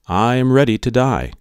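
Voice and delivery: usual speaking voice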